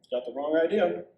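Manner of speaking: ordinary delivery, nothing marked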